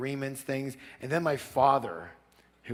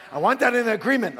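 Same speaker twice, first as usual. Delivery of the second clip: higher, louder, fast insistence; eager demand; 9 semitones higher, 7 dB louder, faster than usual. Retold or imitated. Plumber insisting.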